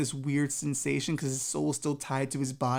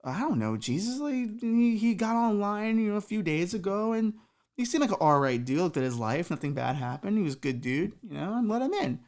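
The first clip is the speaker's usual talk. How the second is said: flustered Peter; 6 semitones higher than usual. Acted. Peter explains nervously.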